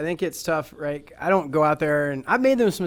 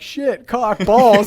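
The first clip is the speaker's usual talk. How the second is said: laughing mock outburst, louder on balls; Will Ferrell swearing; 6 semitones higher, 10 dB louder, much slower than usual. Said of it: "and Will Ferrell's like".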